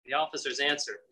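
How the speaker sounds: normal talking voice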